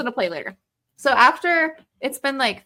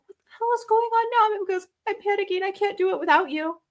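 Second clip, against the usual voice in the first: put-on high, strained child pleading; mock-panicked child voice; frantic kid voice; 9 semitones higher than usual. Panicked kids voiced in story.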